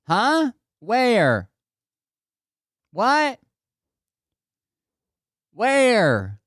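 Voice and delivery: high mock bewildered questions; confused wife. Acting out wife failing to hear.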